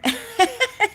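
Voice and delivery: a high, breathy self-conscious giggle; embarrassed little laugh. Real, not acted. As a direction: laugh off your own rambling. Laughing at herself.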